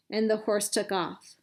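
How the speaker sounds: ordinary delivery, nothing marked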